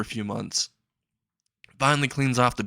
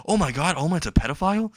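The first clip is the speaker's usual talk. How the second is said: higher, scandalized mock gasp of a question; shocked onlooker; 6 semitones higher than usual. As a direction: imitate a scandalized bystander, pitched up. Onlookers' shocked reaction, acted.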